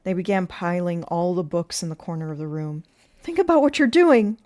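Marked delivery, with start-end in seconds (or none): 3.2-4.4 high, loud plea; raised, urgent appeal; desperate cry. A narrator's reading. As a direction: cry out to stop the mob. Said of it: "cried Miss Catherine".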